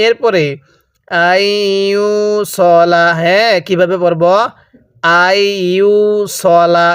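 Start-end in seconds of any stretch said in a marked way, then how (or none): 1.3-3.1 আইয়ুসলা slow, সলা short, never সালা; Quranic recitation. Shown as a demonstration. Teacher correcting a common mistake, slow recitation. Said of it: "কেউ সালা পড়ব না". 5.2-6.9 আইয়ুসলা slow, সলা short, never সালা; Quranic recitation. Shown as a demonstration. Teacher correcting a common mistake, slow recitation. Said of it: "কেউ সালা পড়ব না".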